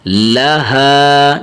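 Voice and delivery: لہا with a long, level final haa; slow recited لہا, short la and long haa; full round ha. Shown as a demonstration. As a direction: give the h of لہا full breath and hold the vowel. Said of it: "اسے موٹا کر کے پڑھنا ہے آپ نے".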